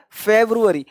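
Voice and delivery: फेब्रुअरी said "FEV-roo-a-ree"; February with "ru". Shown as a demonstration. Teacher showing learners the written form, full "ru" फेब्रुअरी. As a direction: sound the u fully in फेब्रुअरी. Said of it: "तो फेब्रु नहीं".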